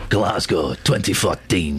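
low, measured sports-promo voice; mock trailer voice. Host parodying the sports trail he just played, mock trailer voice. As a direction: intone it like a trailer announcer.

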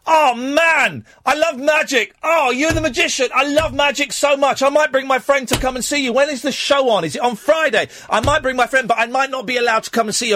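high-pitched, booming, overexcited gushing; loud, over the top. Retold or imitated. Jet-lagged man gushing to a magician, very loud and over the top. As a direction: gush far too loudly, as if deaf in your ears. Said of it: "I was being very loud and over the top".

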